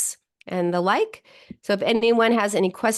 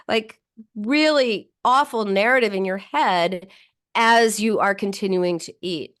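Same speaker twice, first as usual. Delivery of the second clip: normal talking voice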